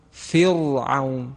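فرعون slow, with a thick, full ra; heavy ra, wrong. Shown as a demonstration. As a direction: demonstrate the ugly thick ra in فرعون. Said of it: "این خیلی زیبا نیستش". (فرعون با راء پُر و نادرست)

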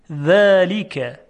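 ذلک very slow, with a thin voiced th; delicate dhal. Shown as a demonstration. Teacher showing that dhal is delicate, slow ذلک. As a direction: draw out ذلک, keep the ذ light. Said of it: "صدای ذال صدای ظریفی هست".

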